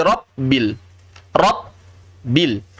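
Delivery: "Rabbil" as rop-bil, with a stop after p; b turned p. Shown as a demonstration. Teacher shows what some people read, "Rabbil" as rop-bil. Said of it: "hati-hati jadi P".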